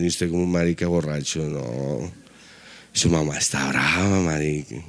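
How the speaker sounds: put-on drunk self-talk. Drunk man talking to himself in the mirror, low slurred mumble. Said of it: "sobre todo cuando uno está borracho".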